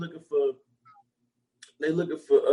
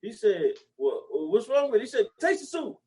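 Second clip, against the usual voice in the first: much higher, acting customer and waiter; high mock joke voices; 5 semitones higher than usual. Telling a joke to a friend, high mock voices. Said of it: "the best joke in the world".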